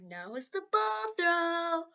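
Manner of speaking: mock-epic announcer voice, slow and high; dramatic announcer. Shown as a demonstration. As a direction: stretch it out like a grand announcer. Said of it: "I'm not gonna say it like I did".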